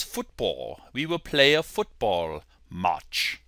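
amateur British accent; mild British imitation. Shown as a demonstration. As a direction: put on British English. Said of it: "give you my British accent".

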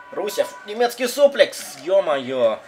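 excited wrestling play-by-play; wrestling commentator. Acted. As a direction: call the match like a commentator. Live play-by-play of the match.

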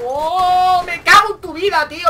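loud, high cry of outrage; angry outburst. Real, not acted. Gamer cursing the enemy who found him, outraged shout. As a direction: curse out loud at the one who got you.

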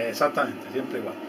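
low, soft, weary sigh of words; resigned. Real, not acted. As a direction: let it drop, fed up. Resigned reply to viewers.

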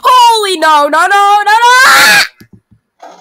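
loud, high panicked no's; panicked screaming. Real, not acted. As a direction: scream no in rising terror. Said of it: "I'm freaking out".

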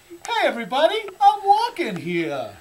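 high, loud New York call, stretched out; New York accent. Pretend. Pedestrian to everybody on the street, mock New York accent. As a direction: holler to the whole street in hammed-up New York. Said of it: "That's my New York accent".

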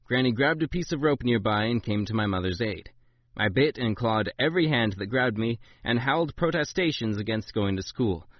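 plain reading voice

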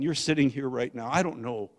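ordinary talk

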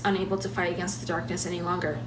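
plain reading voice

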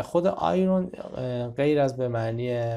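ordinary talk

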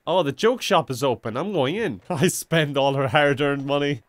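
normal talking voice

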